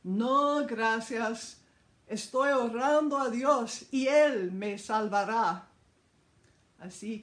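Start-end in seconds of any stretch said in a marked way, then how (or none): 0.0-5.7 loud stubborn shout; shouting back. Retold or imitated. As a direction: yell the refusal over the floodwater. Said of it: "El hombre gritó".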